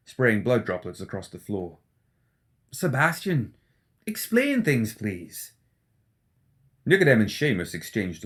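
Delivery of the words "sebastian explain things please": higher, jittery anxious doctor; McMorning, nervous. Acted. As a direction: fret that someone is listening. McMorning voiced.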